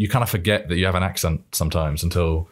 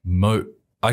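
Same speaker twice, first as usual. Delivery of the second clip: ordinary talk, nothing marked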